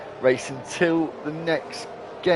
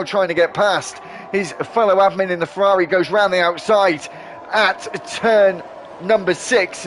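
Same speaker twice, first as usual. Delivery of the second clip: raised, driving race call; motorsport commentator; 5 semitones higher, 5 dB louder than usual. Acted. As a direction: call the race live, building energy. Live race play-by-play.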